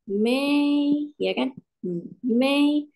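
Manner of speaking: Mei held high and level; teacher models first tone Mei; first tone demo. Shown as a demonstration. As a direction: sing Mei flat and high. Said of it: "kalau nada satu dia datar".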